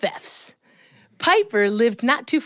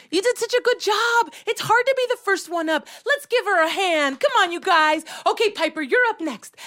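put-on high, brisk praise for a child; rally the class to applaud; upbeat, higher-pitched teacher voice; encouraging teacher; 10 semitones higher than usual. Teacher praises child, calls next.

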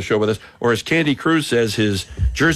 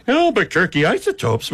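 Isotopes voice; 7 semitones higher than usual. Acted. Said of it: "like the Isotopes one".

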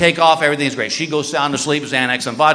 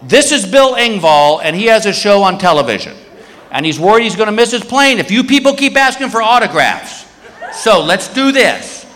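raised, higher announcing voice; addressing a whole line; 4 semitones higher than usual. Retold or imitated. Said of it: "he goes to this whole security line".

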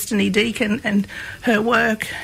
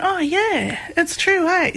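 retold light-bulb moment, pitched high; dawning realisation; 8 semitones higher, slower than usual. Recalling a light bulb moment after dad's answer, realising, high-pitched. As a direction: act out the penny dropping, voice lifted high. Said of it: "a bit of a light bulb moment went off".

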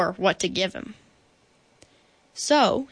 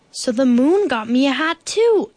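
louder, higher, delighted bear; excited exclamation; 4 semitones higher, 6 dB louder than usual. A narrator's reading. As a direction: exclaim it as a happy discovery. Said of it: "exclaimed Bear".

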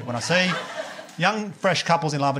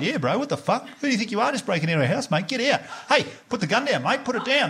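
higher-pitched agitated mock bloke; confronting guy; 7 semitones higher than usual. Man next door to armed intruder breaking in, mock alarm. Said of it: "the guy was like".

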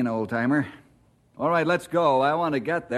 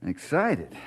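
ordinary talk

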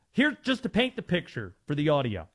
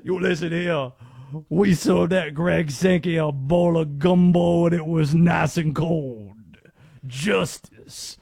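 booming Southern cartoon lawyer impression; Foghorn Leghorn; 2 semitones lower, 5 dB louder than usual. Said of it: "that impression that we were just doing".

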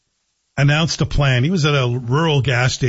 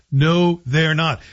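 ordinary talk

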